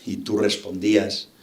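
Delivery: usual speaking voice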